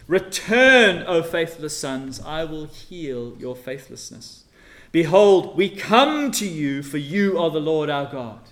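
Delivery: loud call, drawn-out lines; preaching at full force. Acted. Preacher voicing God's call to faithless sons, loud. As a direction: call them home.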